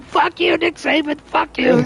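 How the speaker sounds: high-pitched retold yell at Nick Saban; mock screaming. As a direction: yell at the phone, high and furious. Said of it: "screaming on his phone".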